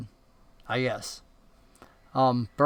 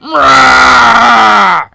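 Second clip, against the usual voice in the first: loud, drawn-out roar; monster roar; 5 semitones higher, 12 dB louder than usual. Acted. Bose roaring at the bear, loud and long. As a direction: let out a long, raised roar. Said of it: "you hear this roar emanate from it".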